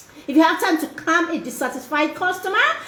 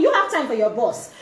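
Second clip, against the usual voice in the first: usual speaking voice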